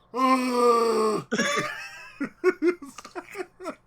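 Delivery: laughing fit. Real, not acted.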